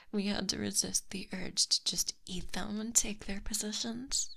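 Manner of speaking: usual speaking voice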